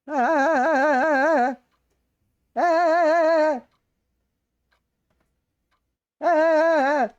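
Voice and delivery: loud, high drawn-out mock wail; mock anguished wail. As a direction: wail in exaggerated despair. Anguished reaction to sad scene.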